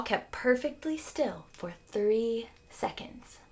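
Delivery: hushed whispered narration. A narrator's reading. Family kneeling silent at the mouse hole, whispered. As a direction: whisper it as if holding your breath.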